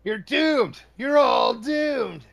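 ominous shout. Acted. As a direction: wail the doom like a prophet. Friend shouts doom line mockingly.

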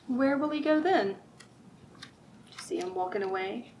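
normal talking voice

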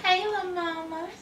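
high sing-song hello to a little one; cooing greeting. Real, not acted. Mom greeting the little one in the hall, high cooing. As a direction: coo it up high and sweet.

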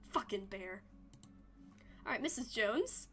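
ordinary talk, nothing marked

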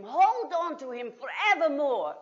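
ordinary talk, nothing marked

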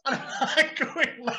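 words squeezed through laughter; laughing. Real, not acted. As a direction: laugh while telling it. Laughing at the memory.

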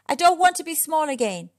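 high, loud call. A narrator's reading. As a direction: call down to friends below. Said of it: "he called down to them".

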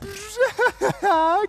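high maniacal cackle; mocking mad cackle; mad scientist laugh. Mocking the duel premise.

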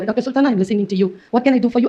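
low, soft, slow device voice; male assistant voice. Retold or imitated. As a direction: imitate a male voice assistant, measured and quiet. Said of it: "அப்படின்னு ஆம்பளை குரல்ல பேசும்". (ஆண் குரல் உதவியாளர் போல, மெதுவாக)

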